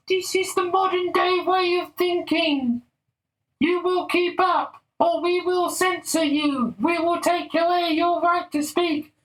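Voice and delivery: very high, slow, sneering falsetto; mock the preachy elite in a squeaky voice; high mocking caricature of a scold; mocking lefty voice. Mocking the left's voice.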